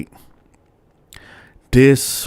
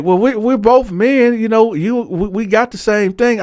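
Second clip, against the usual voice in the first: lightly voiced flustered old man, pitched higher; flustered cellmate; 11 semitones higher than usual. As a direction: play him caught out and apologizing, higher up. Cellmate voiced excusing himself.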